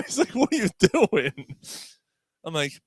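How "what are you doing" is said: laughing mock exasperation. Cop exasperated, teller laughing.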